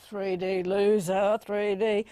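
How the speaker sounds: slow, drawn-out mock teen disdain; scornful teenager. Teenager reacting to an adult's gushing, drawling mock scorn. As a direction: drag out the insult like a teenager. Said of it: "they're gonna look at you and go".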